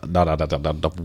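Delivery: ordinary delivery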